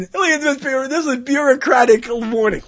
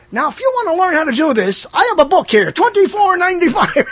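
higher, louder late-night sales pitch; infomercial pitchman; 6 semitones higher, 5 dB louder than usual. Pretend. Mock infomercial host selling his book. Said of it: "I sound like a guy doing an infomercial".

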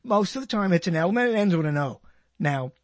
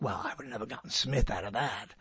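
low, soft muttered excuse; rationalizing mutter; 8 semitones lower, 8 dB quieter than usual. Retold or imitated. Host voicing someone excusing their mistake, low and soft. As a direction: mumble the excuse under your breath. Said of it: "rationalize and say".

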